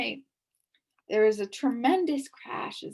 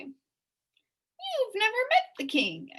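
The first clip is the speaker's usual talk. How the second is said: angry accusation; 13 semitones higher, 3 dB louder, much slower than usual. A narrator's reading. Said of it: "accused the bee angrily".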